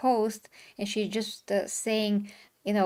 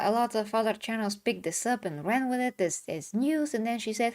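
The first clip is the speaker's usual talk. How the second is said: ordinary talk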